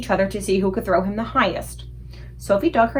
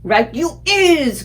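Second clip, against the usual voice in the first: loud, slow giant cry; cried out; 6 semitones higher, 6 dB louder, much slower than usual. A narrator's reading. Blood Bottler crying out in agreement, loud. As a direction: gloat it out. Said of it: "cried the Blood Bottler".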